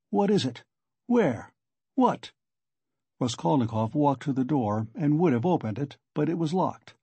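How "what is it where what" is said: high, startled questions; acted alarm. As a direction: fire off anxious questions. Raskolnikov alarmed by the surprise.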